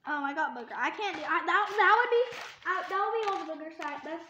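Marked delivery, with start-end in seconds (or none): none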